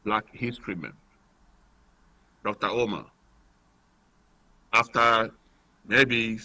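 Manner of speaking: caller on a phone line; thin, distant phone-line voice; radio show caller. Real, not acted.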